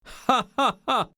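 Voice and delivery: sudden harsh high laugh, no joy in it. A narrator's reading. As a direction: bark out a hard, mirthless laugh. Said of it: "he burst into a harsh laugh".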